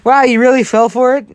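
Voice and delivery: high, loud prankster's gloat; gleeful teasing. Acted. Prankster reveals the fake threat, gleefully teasing. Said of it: "he starts laughing, goes".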